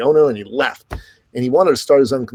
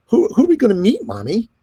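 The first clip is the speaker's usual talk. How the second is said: put-on much higher, a little boy asking his mom; small boy's voice; 11 semitones higher than usual. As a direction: ask like a curious small child. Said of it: "My youngest son, uh, said".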